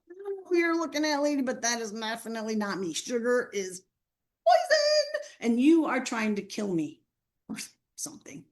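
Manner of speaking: hammed-up teen outrage; mock indignant denial. Student denying to teacher she eats candy, fast and shrieking.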